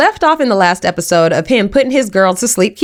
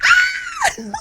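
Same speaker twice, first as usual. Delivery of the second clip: very high, hammed-up squeal of joy; a short delighted scream, played for laughs; mock excited scream; 5 dB quieter than usual. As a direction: shriek like she just put the ring on. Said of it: "she's like".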